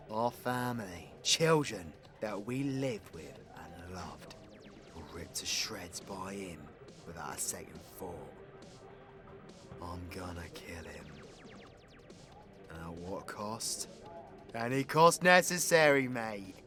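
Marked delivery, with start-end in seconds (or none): 5.0-13.9 low, hushed, deadly serious; grieving vow of revenge. Acted. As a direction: let it sink to a near whisper, grim. Grim vow after family killed.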